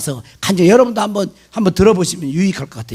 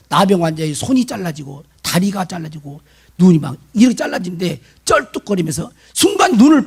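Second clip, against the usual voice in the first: ordinary talk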